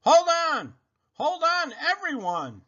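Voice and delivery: high, loud warning cry; urgent shout. A narrator's reading. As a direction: yell hold on to everyone. Car about to crash.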